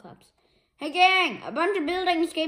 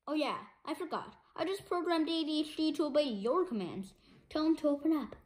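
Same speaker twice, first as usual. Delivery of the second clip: quiet aside; 10 dB quieter, faster than usual. Acted.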